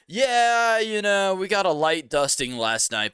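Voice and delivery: high, loud drawn-out start, easing down; imitated Uber driver. Uber driver downplaying a foot of snow, imitated high and loud. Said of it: "And he was like".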